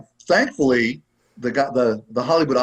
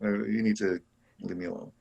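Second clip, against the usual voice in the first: muttered aside; 5 semitones lower, 11 dB quieter than usual. Retold or imitated. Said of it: "I'm like".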